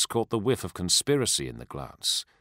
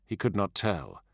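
usual speaking voice

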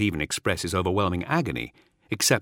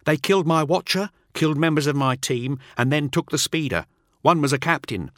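high, anxious report; nervous underling; 8 semitones higher, 3 dB louder than usual. Acted. Teth reporting his losses to Null, high and anxious. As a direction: rush out the bad news. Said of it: "agrees Teth".

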